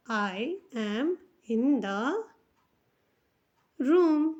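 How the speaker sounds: "I am in the room" drawn out with pauses; slow dictation. Shown as a demonstration. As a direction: dictate it slowly, word by word. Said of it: "जैसे हम कह सकते हैं".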